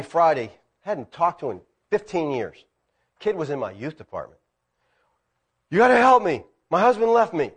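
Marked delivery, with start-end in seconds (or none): 5.7-7.5 higher, louder desperate plea; distraught woman. Retold or imitated. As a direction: voice the caller's panic. Woman begging on phone.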